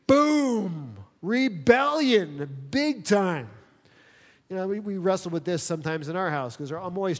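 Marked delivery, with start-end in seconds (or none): 0.1-3.5 dramatic boom. Acted. Dramatic punchline.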